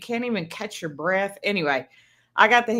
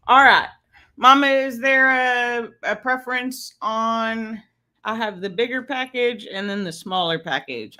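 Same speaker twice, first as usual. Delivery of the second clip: ordinary delivery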